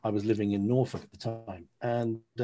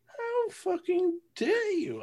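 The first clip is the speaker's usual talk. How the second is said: much higher, slow, hammed-up indignation; mock outrage; much slower than usual. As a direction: squeal it like a wounded ego. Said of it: "I'm going".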